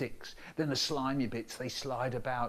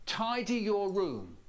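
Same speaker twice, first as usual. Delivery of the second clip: a slow, higher parent's order; 7 semitones higher, 3 dB louder, slower than usual. Retold or imitated. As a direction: lightly voice the nagging parent. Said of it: "They say".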